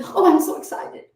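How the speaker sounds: high, breathy thrill; bubbling over with anticipation; excited. Shown as a demonstration. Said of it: "But it can also be".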